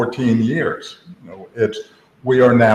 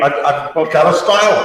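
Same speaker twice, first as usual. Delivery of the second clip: high mocking voice; 6 semitones higher than usual. Mocking Apple's claim.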